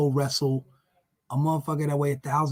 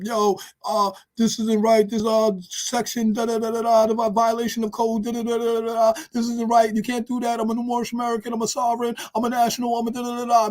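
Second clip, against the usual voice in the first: higher, whiny legalistic protest; sovereign citizen; 5 semitones higher than usual. Retold or imitated. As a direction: whine about your rights.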